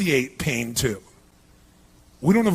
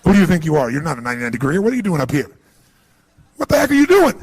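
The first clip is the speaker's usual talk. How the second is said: indignant scolding brain; 2 semitones lower, 6 dB louder, faster than usual. Acted. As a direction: play the outraged inner voice. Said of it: "your brain goes".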